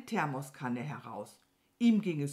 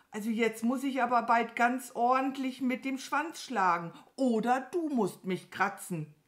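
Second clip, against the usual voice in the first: higher, insistent cow voice; impatient Mama Mu; 7 semitones higher, 4 dB louder than usual. Acted. (ungeduldige, hohe Kuhstimme)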